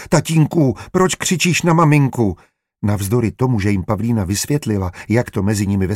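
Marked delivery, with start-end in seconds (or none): 0.1-2.4 put-on high-pitched child on the verge of crying; narrator's high, whimpering child voice; tearful small boy. A narrator's reading. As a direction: plead like an upset little boy. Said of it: "začal hned natahovat".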